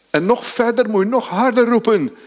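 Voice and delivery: fervent pulpit delivery; impassioned preaching. Acted. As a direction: preach it with fervor, building. Preaching climax.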